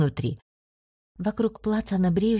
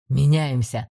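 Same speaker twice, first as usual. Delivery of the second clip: low, authoritative order; commanding voice; 4 semitones lower, 4 dB louder, slower than usual. A narrator's reading. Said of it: "властный голос командора".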